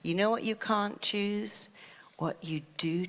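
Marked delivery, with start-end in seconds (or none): 2.2-3.0 hushed, breathless aside; whispered. Real, not acted. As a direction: drop to a whisper to draw them in. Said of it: "speaker whispers here".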